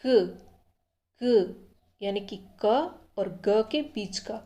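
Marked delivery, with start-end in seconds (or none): 0.0-0.4 क said between k and g, loud and short; sound demo. Shown as a demonstration. Teacher sounding the consonant, a firm single syllable. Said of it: "इसका उच्चारण होगा".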